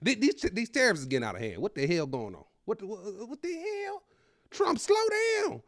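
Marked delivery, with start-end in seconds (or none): 2.7-4.0 much higher, soft, squeaky disbelief; a strangled squeak of shock at the news; high shocked squeal. Real, not acted. Reacting to Trump's tariff news, squeaky shocked disbelief. 4.5-5.6 much higher, whiny plea; high pleading whine. Pretend. Begging Trump to ease off tariffs, high whiny plea.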